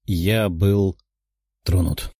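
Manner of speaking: subdued, halting admission after a sigh; heavy-hearted. A narrator's reading. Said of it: "тяжело вздохнув".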